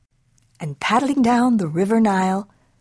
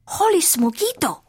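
high-pitched amazed kitten exclamation; exclaiming; 9 semitones higher than usual. A narrator's reading. Kitten spotting himself in the mirror, exclaiming. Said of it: "exclaimed Skippy John Jones".

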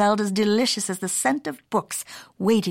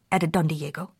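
ordinary delivery, nothing marked

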